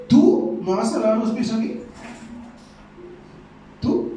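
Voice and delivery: Peter aghast that his master would serve him; high-pitched startled protest; incredulous Peter. Retold or imitated. Peter voiced in disbelief.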